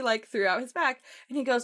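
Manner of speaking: normal talking voice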